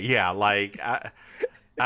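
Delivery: ordinary delivery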